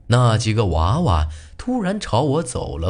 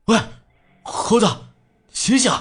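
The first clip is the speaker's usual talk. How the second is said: urgent calling out. Acted. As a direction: shout to snap him awake. Trying to wake possessed friend.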